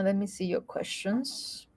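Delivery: ordinary delivery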